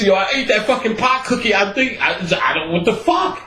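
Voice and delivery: usual speaking voice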